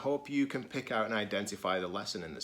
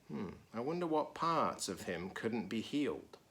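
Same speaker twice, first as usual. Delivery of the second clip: plain reading voice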